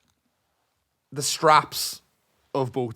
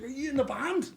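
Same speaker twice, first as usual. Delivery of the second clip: high, much softer imitation of the guy; imitating his question; 11 semitones higher, 10 dB quieter than usual. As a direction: copy how he asked it, high and soft. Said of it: "Like this".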